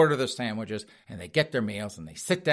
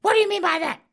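put-on high squeaky offended old lady; indignant falsetto wife; 5 dB louder, faster than usual. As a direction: snap it back in a shrill falsetto.

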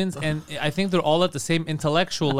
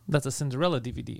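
usual speaking voice

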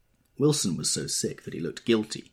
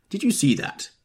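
sharp, higher demand; 11 semitones higher, 8 dB louder than usual. A narrator's reading. Tom demanding an answer from Nick, pointed. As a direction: press the question hard. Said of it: "demanded Tom".

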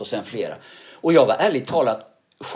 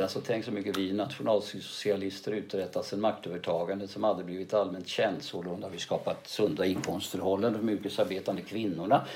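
softly spoken, lower-pitched speech opening; low-key Hitler monologue; 5 semitones lower, 11 dB quieter than usual. Shown as a demonstration. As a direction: start the oration hushed and low, droning on. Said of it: "börjar lågmält också, va, som jag gjorde". (lågmält Hitlertal)

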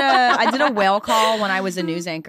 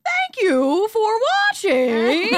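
much higher, very slow, louder whale-like wail; whale call sign-off; 5 dB louder, much slower than usual. Retold or imitated. News anchor ending the show in a whale call. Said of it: "I went".

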